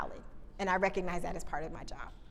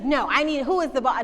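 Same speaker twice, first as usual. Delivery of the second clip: louder, higher indignant demand; angry demand; 4 semitones higher, 8 dB louder than usual. Retold or imitated. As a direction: insist on the boss, fuming. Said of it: "And he's like".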